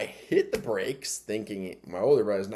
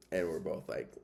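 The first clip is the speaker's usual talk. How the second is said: normal talking voice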